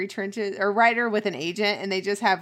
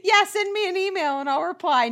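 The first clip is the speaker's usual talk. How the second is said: higher, airy fake-cheerful promise; mock breezy promise; 10 semitones higher than usual. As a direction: promise it brightly, meaning never. Friend mocks Emily's promise.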